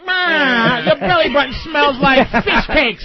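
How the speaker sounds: mocking old-movie gangster voice with drawn-out bleats; Edward G. Robinson. Host teasing a woman about her voice, gangster caricature. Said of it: "Robinson voice with titties".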